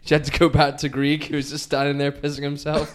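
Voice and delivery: ordinary delivery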